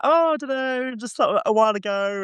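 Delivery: high, airy dodge of a question; put-on offhand vagueness; mock nonchalance. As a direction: imitate someone playing innocent, pitched well up. Retold evasive answer to accusing question.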